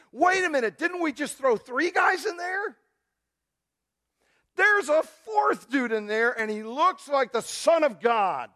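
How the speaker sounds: high, loud, slow shout of amazement; king gasping at the fourth man; astonished king. Acted. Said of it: "the king comes and he goes".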